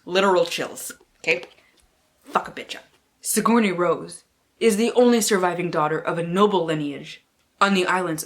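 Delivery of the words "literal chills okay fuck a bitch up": usual speaking voice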